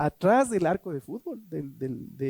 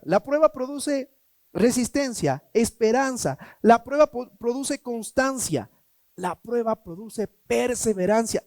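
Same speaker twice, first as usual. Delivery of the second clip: raised, emphatic preaching cadence; 5 semitones higher, 4 dB louder, slower than usual. Acted. Pastor preaching to the congregation on trials. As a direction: drive each point home from the pulpit.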